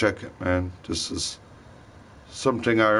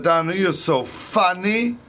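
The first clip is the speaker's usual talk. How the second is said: put-on Caribbean accent; 11 semitones higher, 3 dB louder than usual. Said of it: "I love that accent".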